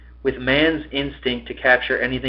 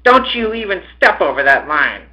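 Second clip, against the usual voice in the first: higher, louder order; commanding; 7 semitones higher, 5 dB louder than usual. A narrator's reading. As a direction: lay down the law in a raised voice. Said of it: "He commanded".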